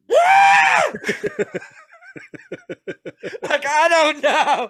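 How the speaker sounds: high, loud laughing yelp; panicked laughing shriek. Retold or imitated. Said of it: "I'm like".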